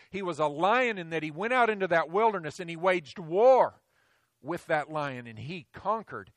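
loud, building sermon voice; full-force preaching. Acted. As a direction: drive it home from the pulpit.

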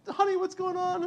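a startled wife asking back; alarmed wife. Retold or imitated. Wife echoing her husband in a high mock voice.

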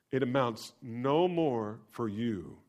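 normal talking voice

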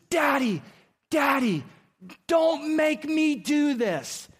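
high, drawn-out pleading yell; imitating screaming child. Imitating son begging his dad as he's wheeled away, screaming. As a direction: cry out like a terrified little boy. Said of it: "screaming at the top of his lungs".

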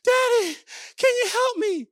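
put-on high-pitched little-kid plea; squeaky, insistent child voice; pleading child. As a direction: mimic a kid urgently begging dad for help. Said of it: "completely out of breath, and this is what they say".

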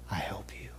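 soft, unvoiced whisper; whispered offer of help. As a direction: say it gently under the breath. Whispered climax of point.

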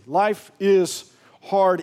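drawn out, each word weighed; slow emphatic. Real, not acted. Preacher slowing down to hammer home life's hardship. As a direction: lean hard on every word.

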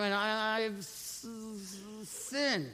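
stuck hissing on the first sound, then it drops out; mock struggle to force out a hard word; struggling to confess. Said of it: "It's like a lot of us".

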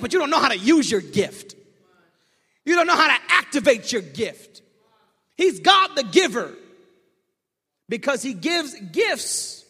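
loud, high, rising preacher's call; building sermon. Acted. Pastor pressing the congregation about unused gifts, fervent building preaching. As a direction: drive it home, building.

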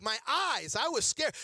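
normal talking voice

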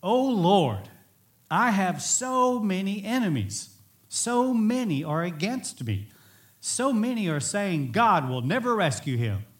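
high, emphatic cry to God; anguished psalm reading. Retold or imitated. David on the run pouring out his hurt to God, anguished reading. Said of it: "full of these emotions of being betrayed and hurt".

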